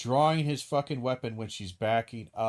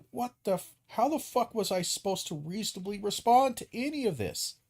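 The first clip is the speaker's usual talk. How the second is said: high, incredulous outburst; stunned disbelief; 9 semitones higher than usual. Retold or imitated. Victim reacting to a sudden punch, shocked and incredulous. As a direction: sputter it like you just got blindsided.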